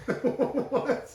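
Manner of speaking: high, shocked squeal of disbelief. Real, not acted. Shocked reaction.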